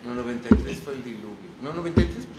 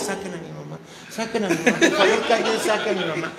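panicked inner plea; 6 semitones higher, 4 dB louder than usual. Retold or imitated. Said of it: "mi único pensamiento es".

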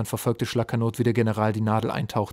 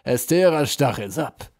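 triumphant; 4 semitones higher, 6 dB louder, slower than usual. A narrator's reading. General savours his captive's confession, triumphant. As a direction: crow over the extracted name. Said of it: "rief der General triumphierend".

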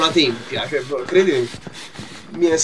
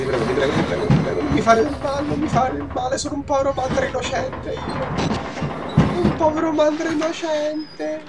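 mock pleading; 7 semitones higher, 3 dB quieter than usual. Player begging not to be hurt, mock pleading. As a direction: play the helpless victim, high and soft. Begs game monster to spare him.

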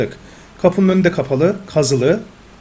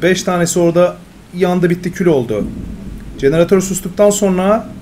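louder, higher recap of the night's defense; dramatic results read; 3 semitones higher, 4 dB louder than usual. Acted. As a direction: read the outcome like a storyteller. Reads round results dramatically.

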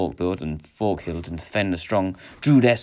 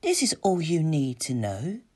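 high, gentle voice at the ear; soft, raised-pitch temptress; soft enchantress; 9 semitones higher than usual. A narrator's reading. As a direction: lure him in gently as an enchantress. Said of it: "said a soft voice at his ear".

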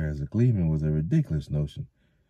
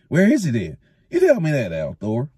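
louder, higher indignant demand; indignant challenge; 9 semitones higher, 7 dB louder than usual. A narrator's reading. Thatcher demanding where the peddler is, indignant. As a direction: throw it back at him.

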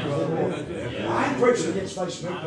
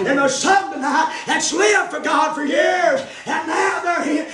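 shouted, slow preaching; hollering revival preacher; 9 semitones higher, 4 dB louder, much slower than usual. Acted. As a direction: preach it out at the top of your voice. Preaching at full force.

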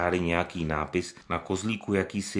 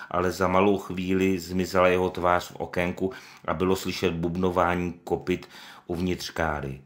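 ordinary delivery

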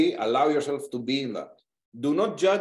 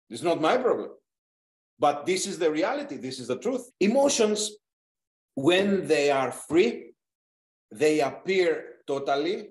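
ordinary delivery

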